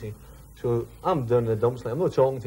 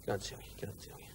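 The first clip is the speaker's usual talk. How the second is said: whispered, hushed refusal; secretive whisper; 4 semitones lower, 16 dB quieter than usual. Retold or imitated. Nico refusing to tell Tom the secret, whispering. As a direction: keep it to a breathless whisper, guarding a secret.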